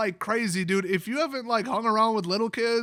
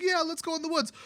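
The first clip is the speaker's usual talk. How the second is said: put-on much higher, bright child's voice; eager little girl voice; 9 semitones higher than usual. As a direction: chirp it like an excited kid. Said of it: "She's like".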